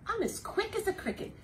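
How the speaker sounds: high, bright quick-as-a-cricket voice; high excited kid voice; quick chirpy voice. Acted. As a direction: squeak it out like a tiny cricket. Reading animal simile book to kids.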